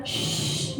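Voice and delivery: long demonstrated "shh"; callar con un shh. Shown as a demonstration. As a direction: hush a child with a drawn-out shh. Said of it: "¿Qué sentiría si te dijera". (siseo largo para callar a un niño)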